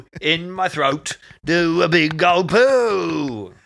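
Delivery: mock punk singer.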